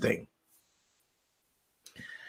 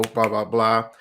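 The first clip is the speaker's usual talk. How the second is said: ordinary talk, nothing marked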